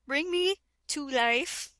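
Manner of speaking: ordinary talk, nothing marked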